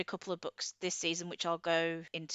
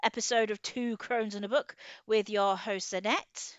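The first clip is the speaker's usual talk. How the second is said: bright, louder welcome to the show; podcast host intro; 3 semitones higher, 4 dB louder than usual. Acted. Host opening a spooky Halloween episode, announcer style. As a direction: greet the listeners like a radio host.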